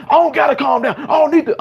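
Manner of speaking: hammed-up refusal to calm down; pretend aggressive defiance. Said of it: "I'm doing all this aggressive behavior".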